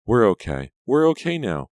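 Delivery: high and loud with relief; relieved. A narrator's reading. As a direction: cry it out, overjoyed. Cousin relieved embracing.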